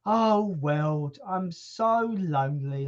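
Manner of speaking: drawn-out mock lament; slow, self-pitying cat voice; lonely cat. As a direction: wail lightly like a melodramatic cat. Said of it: "like a cat out the window going".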